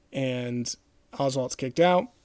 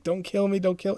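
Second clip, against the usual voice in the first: high-pitched imitation of a nervous nerdy kid; retold panicky kid, pitched up; 6 semitones higher than usual. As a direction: plead in a mock-scared higher voice. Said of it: "this kid's nervous".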